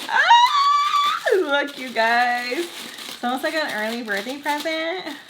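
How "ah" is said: high, loud squeal of delight; excited squeal. Real, not acted. Vlogger delighted by her new purse, excited squeal.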